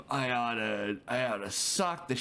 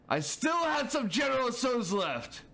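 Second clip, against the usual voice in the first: high, whining complaint; high-pitched mock outrage; whiny outrage; 10 semitones higher than usual. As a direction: whine it like a robbed kid. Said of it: "let me get a good story going".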